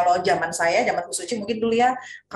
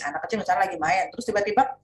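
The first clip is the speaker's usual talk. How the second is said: usual speaking voice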